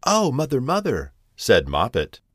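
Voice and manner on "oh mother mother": higher-pitched kitten calling for mother; a touch of storybook fright; mildly frightened. A narrator's reading. Moppet, pulled from the barrel, calls mother, mildly frightened. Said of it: "be in a terrible fright".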